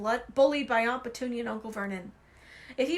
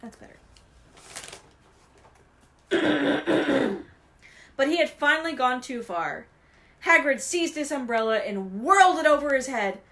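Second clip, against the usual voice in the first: usual speaking voice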